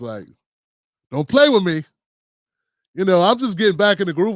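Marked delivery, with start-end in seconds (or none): 1.1-1.8 loud mock warning to the computer gods. Host side-eyeing the computer gods, mock warning. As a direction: warn them off, half joking. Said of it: "I was side-eyeing".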